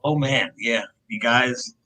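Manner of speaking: ordinary talk, nothing marked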